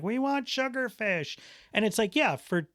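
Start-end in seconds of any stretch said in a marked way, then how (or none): 0.0-1.4 high-pitched kids' demand, imitated; imitated demanding kids. Kids demanding pricey sushi from dad, high mock child voice. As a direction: pipe up like children wanting a treat.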